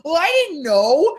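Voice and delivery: high, put-on dopey voice; mock clueless dad; sheepish excuse. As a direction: shrug it off innocently.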